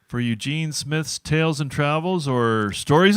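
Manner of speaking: high, loud welcome to the crowd; show announcer. Real, not acted. Host welcomes the audience to the showroom, announcer style. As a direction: open the show big and loud.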